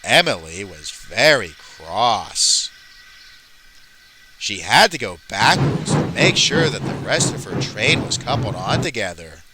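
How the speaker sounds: slow storybook narrator with big pitch swoops; Michael Brandon. Acted. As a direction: narrate the train story in a drawn-out, put-on voice. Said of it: "I am Michael Brandon".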